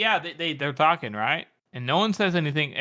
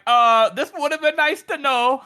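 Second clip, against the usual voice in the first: high, loud, drawn-out comic complaint; mock dismay; 10 semitones higher, 6 dB louder than usual. Longfoot reacting to a companion's confession, mock dismayed. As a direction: stretch it out high in indignant dismay. Voicing Longfoot learning of danger.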